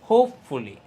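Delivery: loud first syllable in hopefully; stressed syllable. Shown as a demonstration. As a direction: punch হোপ in hopefully. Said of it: "ওইটুকু হলো stressed".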